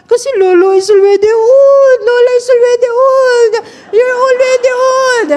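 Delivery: loud, high mock bawling; imitated wailing child. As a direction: wail each complaint like a crying little girl. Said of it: "Iyak pa rin po siya na iyak". (malakas na kunwaring ngawa ng bata)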